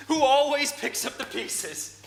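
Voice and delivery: slow exasperated cry at his wife; exasperated outburst. Acted. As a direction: let the frustration burst out. Heated quarrel with Kay.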